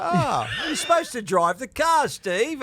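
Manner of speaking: high, sing-song ribbing; ribbing the co-host over his own car, high mock scold; teasing mock scold.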